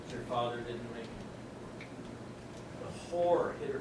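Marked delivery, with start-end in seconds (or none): 0.0-1.9 soft, hushed delivery of a death; doctor telling a daughter her father died, soft and quiet; gentle bad news. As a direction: break the news gently and softly. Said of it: "I said".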